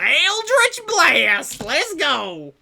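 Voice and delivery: drawn-out, high, loud hype shout; hyped cheer. Real, not acted. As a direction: cheer the spell on like a sports fan. GM hypes the spell cast.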